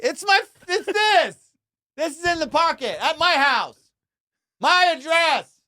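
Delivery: high, loud and slow, stunned disbelief; incredulous outburst. Real, not acted. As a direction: shriek it out in outrage. Finding tracked AirPods in his pocket.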